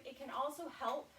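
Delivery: ordinary talk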